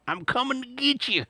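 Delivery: high, laughing cartoon prospector; Yosemite Sam voice. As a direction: menace like an ornery old cowboy. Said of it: "Yosemite Sam catchphrase".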